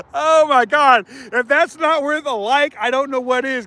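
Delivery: normal talking voice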